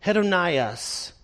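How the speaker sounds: usual speaking voice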